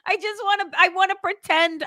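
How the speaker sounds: high, giggly talk through laughter; words breaking into real laughter; laughing. Real, not acted. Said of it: "laughing while speaking".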